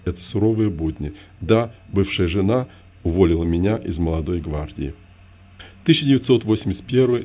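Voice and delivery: ordinary delivery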